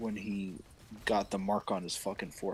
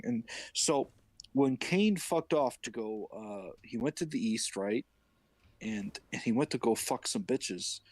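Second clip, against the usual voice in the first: normal talking voice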